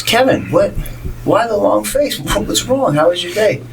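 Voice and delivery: high, worried mom asking after her son; mom's voice. Retold or imitated. Mom picking up her upset son, high and concerned. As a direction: play the fussing mother, well up high.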